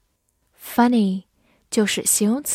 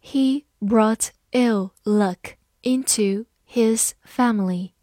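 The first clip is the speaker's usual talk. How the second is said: slow, careful word-by-word reading; deliberate model reading; slower than usual. Shown as a demonstration. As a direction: read it slowly for learners. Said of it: "好，慢慢来读".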